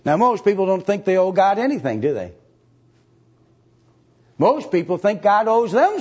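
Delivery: high, loud, fast preaching. Acted. Preacher warning his flock about bitterness, loud fast preaching. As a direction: press the point hard and quick.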